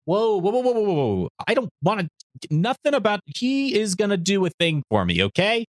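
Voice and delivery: mock protest. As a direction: fend off the accusation, a touch hammed-up. Retold Videl backing off in protest.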